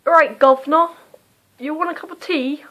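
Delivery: mock cockney offering tea, mostly in her own voice; South London accent. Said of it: "the South London side".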